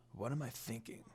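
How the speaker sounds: very soft, low self-questioning; worried to himself. A narrator's reading. Sesshomaru doubting himself, soft and worried. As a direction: ask yourself softly, a little worried. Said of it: "sounded a bit worried".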